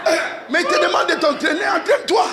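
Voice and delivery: high shouted refrain; full-force preaching. Acted. Preacher drilling the crowd with entraîne-toi, shouted. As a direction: hammer the refrain over the music.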